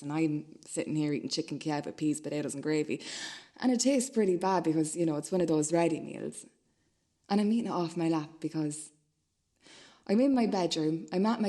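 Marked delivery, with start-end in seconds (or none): none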